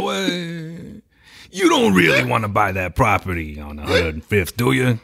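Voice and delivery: wise-guy Italian mobster, laughing through it; smooth mob boss talking business over dinner. Pretend. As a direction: schmooze God like a mob boss. Mock mafia deal at restaurant.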